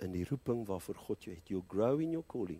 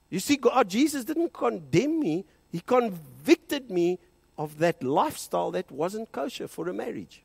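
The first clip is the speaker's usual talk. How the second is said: loud, high preaching, every line driven home; full-force preaching; 8 semitones higher, 8 dB louder than usual. Acted. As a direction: preach it out to the whole hall.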